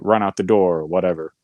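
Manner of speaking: usual speaking voice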